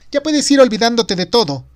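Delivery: high, loud woman's voice on a call; eager, thrilled questioning; excited. A narrator's reading. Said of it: "Parecía emocionada". (voz de mujer emocionada)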